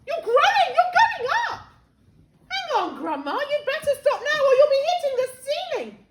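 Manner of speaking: high, loud alarmed yelling; startled shout. A narrator's reading. George warning his growing Grandma, startled shout. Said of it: "George gave a jump and shouted".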